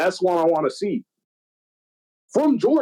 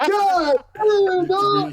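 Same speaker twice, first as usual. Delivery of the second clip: mock crowd reaction; much slower than usual. Voicing crowd after a beatdown.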